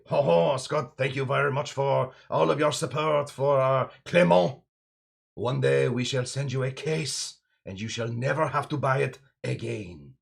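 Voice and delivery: hammed-up French accent, thanking Scott grandly; a mock French distiller speaking with a thick French accent. Imagined Martinique distillers thanking him, in a mock French accent. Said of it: "that was a spot-on French accent".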